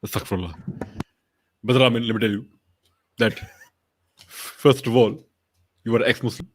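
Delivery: low, halting mock preacher; deliberate, low sermon opening to a brother; Zakir Naik. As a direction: begin a grave reproof, pausing after each phrase. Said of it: "what would Zakir Naik say to Armin".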